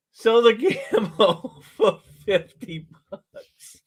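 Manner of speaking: slow call broken by laughter; laughing. Real, not acted. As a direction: laugh through the announcement. Laughing through the sale call.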